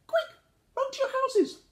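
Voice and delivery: tiny squeaky voice, very high; Meerkat squeaking. A narrator's reading. Meerkat urges the animals to hide, squeaking. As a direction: squeak it out in a panic. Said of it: "squeaked Meerkat".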